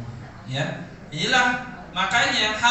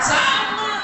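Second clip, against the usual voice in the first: shouted answer; 13 semitones higher, 5 dB louder than usual. Real, not acted. Class answers together.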